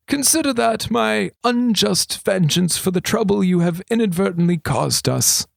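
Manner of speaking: a hint of a sly old regent. A narrator's reading. Regent confiding to thief, lightly voiced old man.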